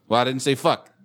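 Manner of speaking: ordinary talk, nothing marked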